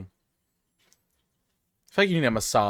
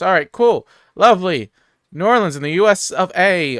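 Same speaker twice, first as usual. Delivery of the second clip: excited; 5 semitones higher, 8 dB louder than usual. Real, not acted. Evidence leads to New Orleans.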